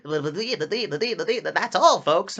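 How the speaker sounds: playful high cartoon sign-off; classic cartoon sign-off. Shown as a demonstration. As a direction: sign off like an old cartoon pig. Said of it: "do the standard".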